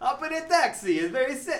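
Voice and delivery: put-on loud, high accent sliding into Eastern European; badly faked accent turning Eastern European; botched Caribbean accent. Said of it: "oh, that's turned in Eastern Europe".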